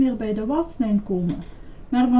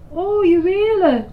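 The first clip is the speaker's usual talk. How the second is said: high, loud delighted exclamation; exclaiming; 10 semitones higher, 5 dB louder than usual. A narrator's reading. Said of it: "riep Lucretia uit".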